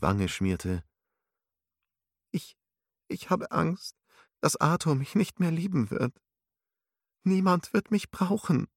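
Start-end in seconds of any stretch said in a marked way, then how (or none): 2.3-8.7 broken trembling voice. A narrator's reading. Alice confessing her fear to Lyria, voice breaking. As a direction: fight back tears, voice cracking. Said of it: "mit gebrochener, zitternder Stimme".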